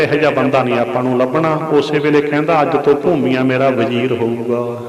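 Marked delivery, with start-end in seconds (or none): 2.6-4.8 lower, softer royal proclamation; king's decree. Acted. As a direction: announce it like a king naming his minister. King proclaims in story. (ਰਾਜੇ ਦਾ ਨੀਵਾਂ ਸ਼ਾਹੀ ਐਲਾਨ)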